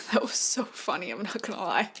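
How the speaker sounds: hushed giggly whisper to viewers; whispered aside. Real, not acted. Reader whispering to viewers, amused at her own reading. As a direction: drop to a near whisper, amused.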